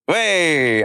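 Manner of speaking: a loud high call as the hands pop out; excited. Shown as a demonstration. Teaching the Billy Elliot move, calling out the pop. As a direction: sell the move with a bright shout. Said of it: "demonstrating the vogue pop".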